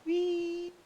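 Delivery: high, playful whee as the ball flies; gleeful whee. Real, not acted. Ball flying off course.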